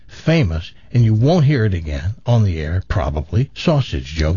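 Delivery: normal talking voice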